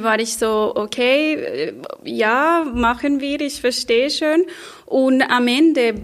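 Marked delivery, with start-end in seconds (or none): none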